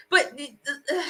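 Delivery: a crack of tears, then a breathy sigh; tearful Kanari. Acted. Kanari to the noble she protects, tearful and breaking. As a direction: hold back a sob and let it out as a sigh.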